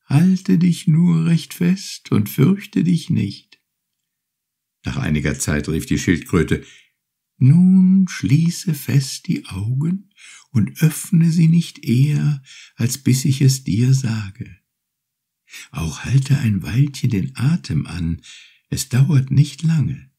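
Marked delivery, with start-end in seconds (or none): 0.1-3.6 high, gentle turtle voice, unhurried; grateful little turtle. Acted. Turtle thanks Urashima for freeing her, high and gentle. 7.4-20.1 high, gentle turtle voice, unhurried; grateful little turtle. Acted. Turtle thanks Urashima for freeing her, high and gentle.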